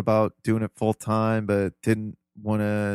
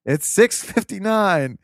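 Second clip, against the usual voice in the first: much higher, slow imitation of grandpa; grandpa announcing the time; 10 semitones higher, 4 dB louder, slower than usual. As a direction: drag out the time, then chirp the wake-ups. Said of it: "he called again".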